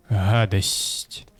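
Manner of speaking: ordinary talk, nothing marked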